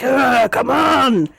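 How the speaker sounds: mock battle roar, drawn out; imitating the charger. Game master recalling who charged up, mock battle cry. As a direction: growl into a slow mock taunt. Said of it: "was it you who was like".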